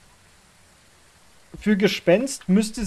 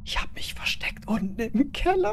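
whisper; 7 semitones higher, 5 dB quieter than usual. Real, not acted. Hiding from ghost in cellar.

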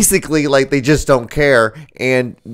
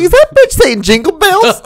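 high mock-incredulous voice, breaking into laughter; high-pitched disbelief; 4 dB louder than usual. Co-host voices the onlooker at the conference, high-pitched disbelief. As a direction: imitate him in a squeaky, laughing falsetto. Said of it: "with a really high-pitched voice".

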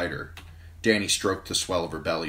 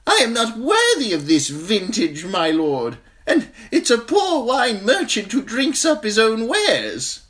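high, bright merchant voice; oily wine seller charm; wine seller, smiling; 9 dB louder than usual. A narrator's reading. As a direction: sell it sweetly, pitched way up. Merchant dodging drinking.

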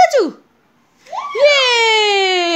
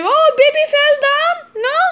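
mock alarm; 8 semitones higher, much faster than usual. Game with toddler, doll falls.

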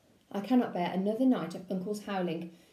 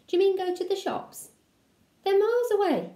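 put-on dismayed young wolf; 11 semitones higher than usual. Little asks uncle, dismayed.

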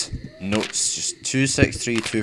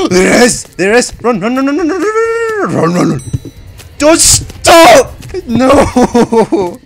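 panicked screaming; 11 semitones higher, 10 dB louder than usual. Real, not acted. Fleeing monster, panicking.

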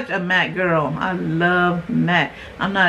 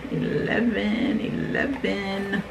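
soft drawn-out count; 6 dB quieter, slower than usual. Real, not acted. Draws out the next number.

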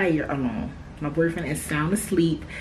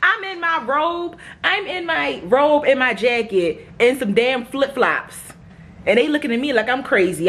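indignant; 6 semitones higher than usual. Real, not acted. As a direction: spell out the outfit, scandalized. Indignant at being underdressed downstairs.

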